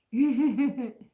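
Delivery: a short bright burst of laughter. Real, not acted. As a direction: laugh out loud at the cake joke. Laughs after teasing cake line.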